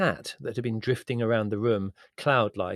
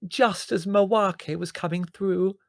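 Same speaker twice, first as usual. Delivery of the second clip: howling; 11 semitones higher, 6 dB louder, slower than usual. Acted.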